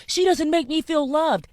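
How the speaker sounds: high-pitched mocking whine; mock crying. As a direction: imitate a self-pitying man whining. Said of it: "they cry to us".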